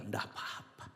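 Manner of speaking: very low, barely voiced reply; hushed, resigned murmur; calm acceptance. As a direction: let it go softly. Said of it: "Tapi bilang".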